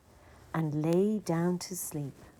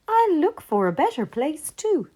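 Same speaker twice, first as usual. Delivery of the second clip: rabbit voice; 9 semitones higher, 4 dB louder than usual. A narrator's reading.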